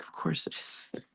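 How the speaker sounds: breathy whispered aside, almost unvoiced. Retelling the busboy chat, a knowing whispered aside. Aside about the necklace reveal.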